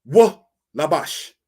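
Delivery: slow, weighty "wa labash" for a class; shown pronunciation. Shown as a demonstration. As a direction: say "wa labash" slowly and distinctly, teacher demonstrating Hebrew. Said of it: "it is said as".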